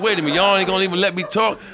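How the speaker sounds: high, loud, fast disbelief; indignant protest. Retold or imitated. Comic pleading with a booing crowd, high and fast. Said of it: "I was like".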